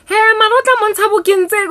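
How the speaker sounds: high, loud cooing mother; mother picks the girl for her son Peter, high and loud; gushing matchmaker mom. Retold or imitated. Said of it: "mother insists again".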